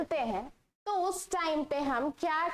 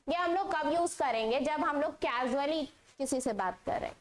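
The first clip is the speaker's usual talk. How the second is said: ordinary talk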